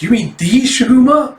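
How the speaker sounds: high, loud incredulous question; astonished. Acted. Staffer hears who is filling in, astonished. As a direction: gasp it out as a rising question, stunned. Staff reacting to the replacement's name.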